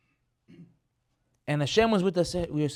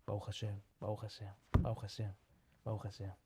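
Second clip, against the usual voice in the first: very soft, low repeated prayer; acting out a private murmured prayer under the breath; low, hushed, as if only for oneself; murmuring to oneself; 8 semitones lower, 11 dB quieter than usual. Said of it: "whispering to himself".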